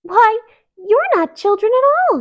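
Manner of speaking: high, loud amazed princess; surprised. A narrator's reading. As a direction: marvel at them. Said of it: "stared in surprise".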